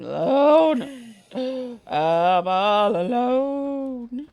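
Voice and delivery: slow, hammed-up despair; mock lament. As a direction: wail it like a lost soul. Dramatic lament alone in game.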